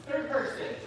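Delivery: ordinary delivery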